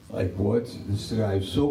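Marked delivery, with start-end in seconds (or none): none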